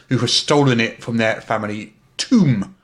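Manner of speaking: ordinary talk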